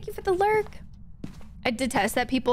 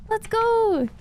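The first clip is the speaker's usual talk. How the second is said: higher, louder cheer; excited cheer; 10 semitones higher, 3 dB louder than usual. Real, not acted.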